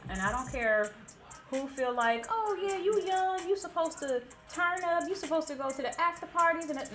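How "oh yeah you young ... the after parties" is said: higher-pitched imitation of party pushers; mocking peer pressure. Party pushers to a young woman, mocking imitation. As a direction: mimic them egging you on, up high. Voicing people who pressure her.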